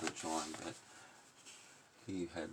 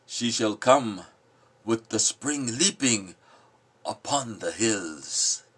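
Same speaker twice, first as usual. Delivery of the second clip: louder, declaiming the lines; forceful poem reading; 5 semitones higher, 10 dB louder, slower than usual. Acted. Giving voice to the passionate in the poem, loud declaiming. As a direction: proclaim it like a storm. Snowbound speak.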